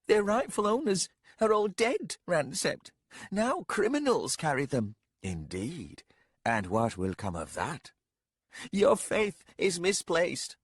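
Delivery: put-on defiant young lady, arguing with him. Young lady voiced by narrator.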